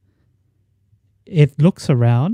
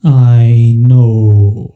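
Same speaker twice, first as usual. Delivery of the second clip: giant whale voice; 8 dB louder than usual. Acted. Whale answers the party.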